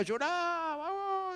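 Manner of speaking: high, crying wail of a hired mourner; hammed-up weeping like a paid old mourner; mock mourner's wail. Pastor imitating the hired mourner's crying at the wake, mock wail. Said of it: "se contrataban lloronas".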